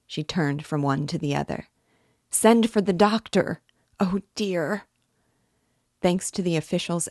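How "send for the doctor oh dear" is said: high, distressed cry; imploring. A narrator's reading. Sonia begging someone to fetch help, anxious plea. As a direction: plead for help in dismay. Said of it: "Sonia implored".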